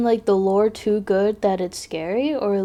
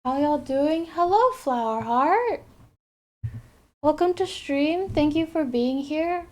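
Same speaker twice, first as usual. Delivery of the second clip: bright, high welcome; upbeat greeting; 6 semitones higher than usual. Real, not acted. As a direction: greet the chat cheerfully. Greeting chat viewers.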